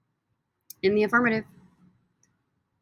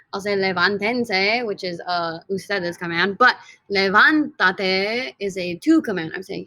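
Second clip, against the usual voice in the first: usual speaking voice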